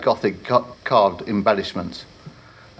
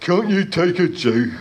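higher troll voice with a laugh; chuckling troll; 3 semitones higher than usual. Acted. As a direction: grumble a joke and chuckle. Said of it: "the troll mumbled".

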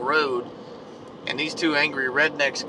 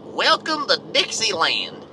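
slow mock-Southern sign reading; 7 semitones higher, 4 dB louder than usual. Shown as a demonstration. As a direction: read the sign like a proud backwoods local. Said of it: "what I imagine in a voice, if you was to read it out loud".

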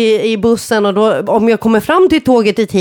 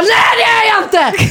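mock indignant shout; 13 semitones higher than usual. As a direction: fire back the denial. Denying being schizophrenic.

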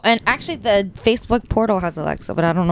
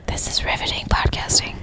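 sarcastic whisper; 4 dB quieter than usual. Real, not acted. Sarcastic aside during dull call.

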